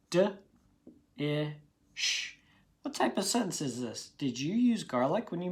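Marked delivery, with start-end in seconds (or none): 0.1-2.4 D-ish stretched into d and ish; sounding out. Shown as a demonstration. Teacher sounding out D-ish for spellers.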